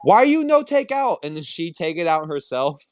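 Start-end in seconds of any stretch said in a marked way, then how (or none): 0.0-1.2 imitating sushi trainer. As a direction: scold like the sushi lady. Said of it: "she'd be like".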